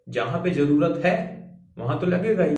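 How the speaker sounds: usual speaking voice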